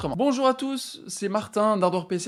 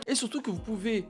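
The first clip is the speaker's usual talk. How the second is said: ordinary delivery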